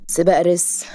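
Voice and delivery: unimpressed questioning repeat; dry, sceptical hero's question; incredulous echo. Acted. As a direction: echo it back in doubt. Hero echoes her in disbelief.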